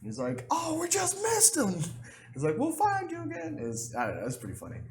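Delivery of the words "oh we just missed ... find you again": very high put-on voice; mock captor voice. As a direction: tease the dead player from above. Captors talking over dead player.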